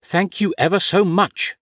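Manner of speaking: high, sharp sarcastic thanks; sarcastic snap. Acted. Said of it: "she snapped".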